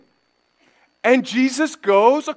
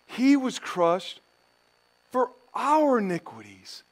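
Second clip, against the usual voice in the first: scripture reading; slower than usual. Acted. Preacher reading Isaiah to the congregation, hushed. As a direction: read the prophecy hushed and solemn.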